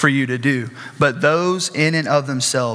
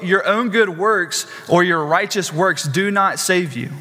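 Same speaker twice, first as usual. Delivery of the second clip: ordinary talk, nothing marked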